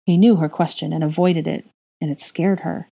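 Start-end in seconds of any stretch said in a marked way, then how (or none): none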